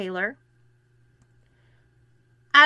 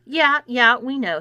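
curt, louder Yeah, yeah; terse; 4 semitones higher, 7 dB louder than usual. A narrator's reading. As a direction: snap it out impatiently. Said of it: "said Clarissa tersely".

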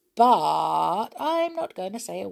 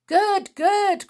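high, loud delighted praise; loud excited approval; cried; 14 semitones higher, 8 dB louder than usual. A narrator's reading. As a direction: cheer him on. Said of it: "cried Rossi".

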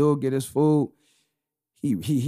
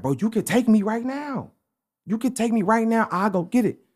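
imitated eager pleading; 8 semitones higher than usual. Homeless man asks his helper for a ride, imitated eager pleading. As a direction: press the offer as the man did.

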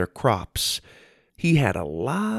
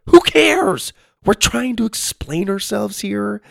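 high, louder, fed-up outburst; exasperated snap; 12 semitones higher, 5 dB louder than usual. Acted. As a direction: scold them for wasting time. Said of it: "Lydia snapped".

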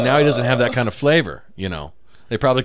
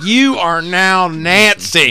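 slow mock decree; 2 semitones higher, 4 dB louder, much slower than usual. Mock renaming a kid.

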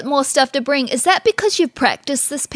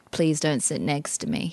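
low, soft and slow imitated begging; hushed, drawn-out pretend plea; mock quiet plea; 9 semitones lower, 10 dB quieter, slower than usual. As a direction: plead under your voice as a dreading passenger. Voices passengers dreading a seatmate.

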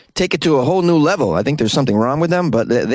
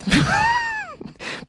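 high giggle; 6 dB quieter than usual. Real, not acted. Laughing at own attempt.

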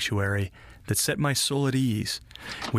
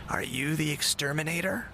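put-on higher, soft old lady; gentle elderly woman asking; 5 semitones higher, 3 dB quieter than usual. A narrator's reading.

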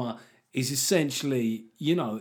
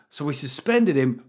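slow, loud sarcastic proposal; mock it with a grin; 3 semitones higher, 7 dB louder than usual. Mocking Labour party.

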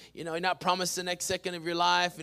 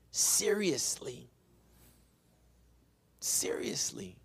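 hushed emphasis; 4 dB quieter, slower than usual. Real, not acted. Pastor telling church how to take Jesus, hushed. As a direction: drop to a grave, drawn-out hush.

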